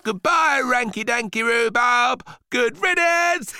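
put-on high, loud boy's crowing; gloating boy. A narrator's reading. Boy bids flushed rhubarb farewell, loud gleeful crowing. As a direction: crow it loudly and shrilly with glee, ending in laughter. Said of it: "the boy would say as he watched".

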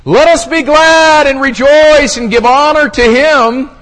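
high, booming, sustained proclamation; heavenly voice. Acted. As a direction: declaim it at full volume, stretching the ending. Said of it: "Here, here's the voice again".

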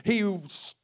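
normal talking voice

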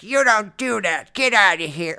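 loud, high-pitched scolding teacher impression; cranky older teacher. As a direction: snap like a fed-up old teacher. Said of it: "I definitely see some of that".

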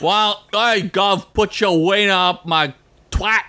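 a badly faked British accent. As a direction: ham up a clumsy English accent. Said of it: "accents we're not good at doing".

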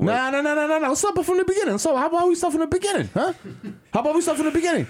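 high, heated demanding voice; angry son. Pretend. Son confronting absent father.

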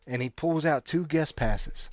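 ordinary delivery

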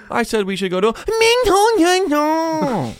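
put-on very high, slow, loud, sing-song Chinese accent; mock Chinese accent. Mocking restaurant name in a high Chinese accent. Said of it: "I don't know if you can say it like that".

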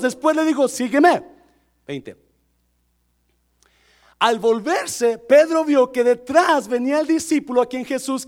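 loud, high, projected Bible reading; forceful pulpit reading; preacher reading scripture. Real, not acted. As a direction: proclaim the verses to the congregation. Reads the passage to church.

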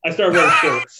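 laughing while repeating the line. Real, not acted. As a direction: laugh through the words. Laughing echo of friend's joke.

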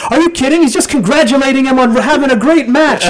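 high, sarcastic wrestling commentator; mock-innocent heel announcer. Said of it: "ridiculous shit that Bobby Heenan would say".

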